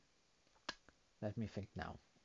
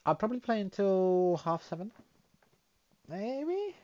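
excited; 10 semitones higher, 8 dB louder than usual. Real, not acted. Loud high questioning.

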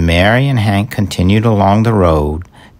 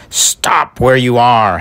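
higher-pitched halting call; yelled order; 6 semitones higher than usual. A narrator's reading. Hank halting Red the Robin, yelled order. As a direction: call out a command, a moderate yell. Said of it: "Hank yelled between hiccups".

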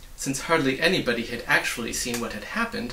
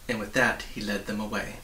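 usual speaking voice

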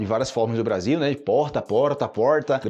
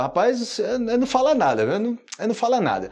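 much higher mock protest; mock denial; 9 semitones higher than usual. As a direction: pretend to refuse to gossip. Quoting someone refusing to talk. (negando de brincadeira, bem agudo)

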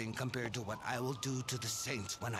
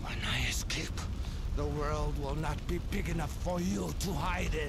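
louder, higher vengeful threat; furious threat; 6 semitones higher, 6 dB louder than usual. Acted. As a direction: spit the promise of revenge. Villain threatens while trapped.

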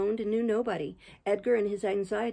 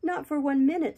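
high, louder woman's character voice; refusing Catherine; 7 semitones higher, 5 dB louder than usual. A narrator's reading. Catherine answers her lover's plea to leave, high and adamant. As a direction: refuse him outright, pitch raised.

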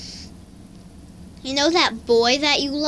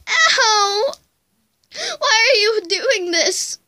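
high, tearful whimpering plea; crying; 6 semitones higher, slower than usual. Acted. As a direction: cry out to the bully cat through tears. Attacked cat cries.

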